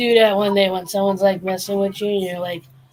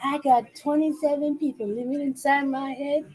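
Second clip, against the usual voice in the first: high-pitched bellboy complaint; hammed-up warning in a raised pitch; mock exasperated warning; 5 semitones higher than usual. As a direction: imitate a man pushed too far. Acting bellboy warning the manager.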